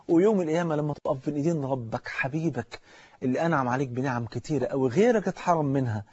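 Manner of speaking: ordinary talk, nothing marked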